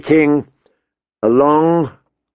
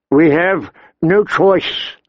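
ordinary delivery

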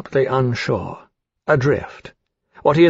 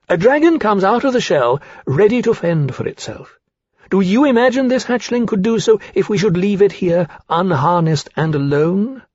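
higher, emphatic argument; haughty challenge; 8 semitones higher than usual. Acted. Rankin argues with Lawrence over the hatchling's fate, haughty. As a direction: press the question with scornful insistence.

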